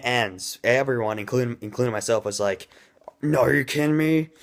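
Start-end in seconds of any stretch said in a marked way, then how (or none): 3.2-4.3 higher-pitched, mock-incredulous protest; frustrated disbelief. Reviewer voicing viewers at the cliffhanger ending, frustrated disbelief. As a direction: act out a viewer in exasperated disbelief. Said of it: "everyone was frustrated at the ending".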